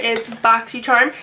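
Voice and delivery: ordinary delivery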